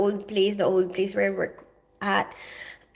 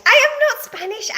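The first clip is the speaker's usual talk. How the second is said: indignant protest; 5 dB louder than usual. Real, not acted. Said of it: "protesting to a viewer".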